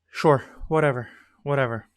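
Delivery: ordinary talk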